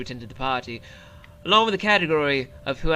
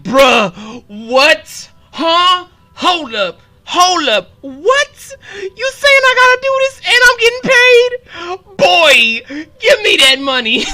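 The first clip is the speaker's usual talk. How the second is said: high, loud stunned shouts; incredulous yelling; 12 dB louder, slower than usual. Real, not acted. Streamer stunned to be paid for fun, incredulous yelling. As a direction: yell in giddy disbelief. Mock disbelief at getting paid.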